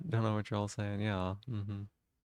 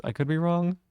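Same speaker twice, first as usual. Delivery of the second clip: coy sing-song; 7 semitones higher, 5 dB louder than usual. Pretend.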